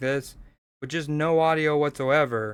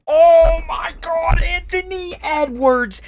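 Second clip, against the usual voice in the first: excited shout; 14 semitones higher, 8 dB louder, slower than usual. Real, not acted. Reacting to a huge dunk.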